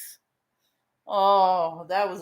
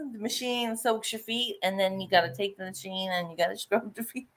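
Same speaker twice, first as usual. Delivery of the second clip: ordinary delivery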